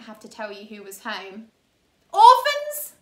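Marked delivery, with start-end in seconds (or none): 2.1-2.9 loud, high scratchy villain's call. A narrator's reading. Count Olaf calling for the orphans, loud and scratchy. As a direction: bellow it out like a sneering villain. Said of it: "Count Olaf called out in his scratchy voice".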